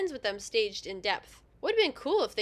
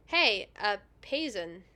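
defeated, questioning; slower than usual. Real, not acted. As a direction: give up on Payson, saying "PAY-zun" with exhaustion in the voice. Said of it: "just the defeated tone".

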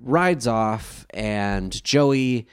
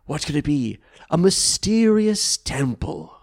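mock suspense; 5 semitones higher than usual. Host unveiling the temple in an anime recap, mock suspense. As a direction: draw it out like a dramatic storyteller.

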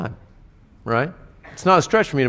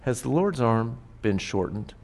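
softer, hushed rhetorical question; gentle God; 8 dB quieter than usual. Retold or imitated. God gently answering the preacher's own doubts, softly. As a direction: ask it quietly, like a tender reminder. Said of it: "And God would say".

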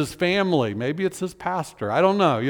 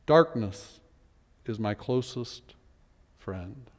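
"Darkness" held, "is my closest friend" fading much lower and softer to almost nothing; fading lament; 6 semitones lower, 7 dB quieter, slower than usual. Acted. Preacher repeating the bleak line a final time, fading. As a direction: let the last word die out. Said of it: "Not you, God".

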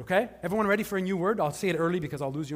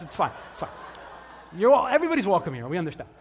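ordinary talk, nothing marked

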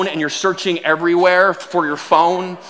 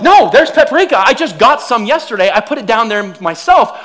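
high, louder, insisting; insistent wife; 5 semitones higher, 4 dB louder than usual. Retold or imitated. Wife insisting the paprika is downstairs, louder and high. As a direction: insist firmly. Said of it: "she'll be like".

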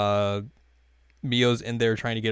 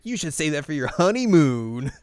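louder, rising on the last word; retold teasing; 5 semitones higher, 4 dB louder than usual. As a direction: tease it with a big finish. Acted teasing quote from the episode.

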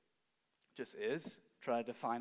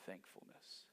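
breathy whispered final word; hushed close; 7 semitones lower, 18 dB quieter than usual. Real, not acted. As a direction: let the word fade to a whisper. Soft landing of sentence.

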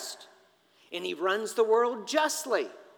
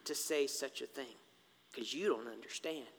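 low, hushed rebuke; God responding; 7 semitones lower, 10 dB quieter than usual. Acted. God answering Job's accusation, low and hushed. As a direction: rebuke softly, sinking ever quieter. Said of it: "And God responds".

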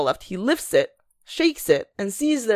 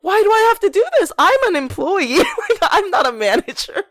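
higher, laughing, exasperated complaint; exasperated whine; 12 semitones higher than usual. Retold or imitated. Complaining about having to scold a coworker, half-laughing whine. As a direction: whine it like she's at her wit's end. Her whiny complaint, retold.

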